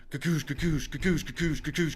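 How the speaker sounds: rhythmic mimicked clanking of a push bar; imitated door noise. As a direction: repeat it like a push bar banging. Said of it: "there's this sound that's going".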